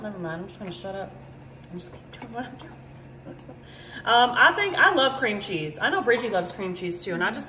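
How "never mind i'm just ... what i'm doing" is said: much softer, lower aside to herself; self-muttering. Real, not acted. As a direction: give up and mumble it quietly.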